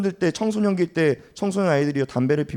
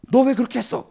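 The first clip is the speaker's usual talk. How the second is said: higher-pitched accusing demand; pressing reproach; 9 semitones higher than usual. Acted. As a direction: press the question like a scolding parent. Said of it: "다그치는 게 아니라는 거예요".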